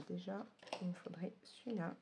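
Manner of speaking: whispered aside to herself. Real, not acted. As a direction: whisper it under your breath. Aside while picking a pen.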